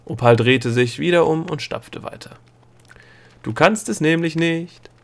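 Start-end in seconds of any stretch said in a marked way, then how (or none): 3.4-4.9 high, taunting call; calling after him. A narrator's reading. Girl following the stomping gnome, calling after him. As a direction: lightly call it after someone walking off. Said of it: "rief sie ihm hinterher".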